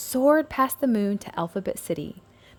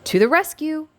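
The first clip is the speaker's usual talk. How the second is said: high, loud rallying cry; heroic shout; 9 semitones higher, 5 dB louder than usual. A narrator's reading. Little R rallying the letters to the rescue, loud high shout. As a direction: call the team to action. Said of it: "Little R shouted".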